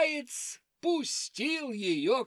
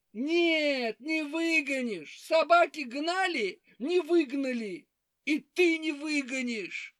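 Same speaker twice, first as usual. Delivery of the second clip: high hare voice; 6 semitones higher than usual. Acted. Hare doubts wolf.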